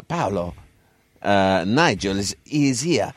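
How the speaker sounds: lightly voiced Italian-accented retelling; hint of an Italian accent, conspiratorial; imitating Razor's words. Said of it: "Razor Ruddock came out to me and said".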